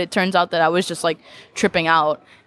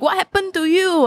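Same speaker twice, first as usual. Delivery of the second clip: massage auntie; 12 semitones higher than usual. Retold or imitated. Massage auntie voiced in story.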